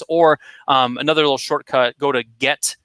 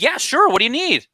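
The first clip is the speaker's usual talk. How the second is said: much higher, bright eager yes; eager client; 12 semitones higher than usual. Retold or imitated. Client gladly agreeing to do a favor, much higher and eager. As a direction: play the happy client jumping at the chance. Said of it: "your client's gonna be like".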